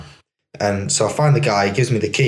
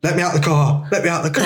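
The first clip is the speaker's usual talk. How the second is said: high-pitched, mock-panicked begging; retold panic, pitched up; urgent plea; 6 semitones higher than usual. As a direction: act out pleading to get out, urgently. Retelling panicked plea to get out.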